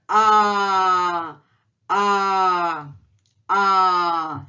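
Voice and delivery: long, open "A" held steady; wide-open A. Shown as a demonstration. Teacher models the letter A for learners, wide-open and sustained. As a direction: draw out "A" with the mouth wide open. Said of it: "opened his mouth very wide".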